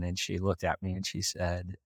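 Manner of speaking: normal talking voice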